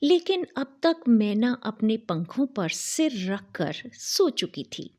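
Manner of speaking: ordinary delivery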